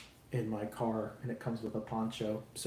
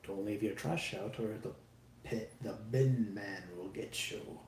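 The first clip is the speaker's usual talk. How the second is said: soft, lightly voiced creepypasta narrator; a hint of spooky storytelling, kept quiet; 3 semitones lower, 6 dB quieter than usual. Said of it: "It sounds like a creepypasta".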